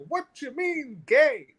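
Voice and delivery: put-on quoting his father in a high, heavy Arabic accent. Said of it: "he had this heavy Arabic accent".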